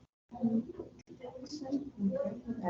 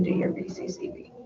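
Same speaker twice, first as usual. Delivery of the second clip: whispered hint; 4 dB quieter than usual. Real, not acted. Quiet hint to student during work.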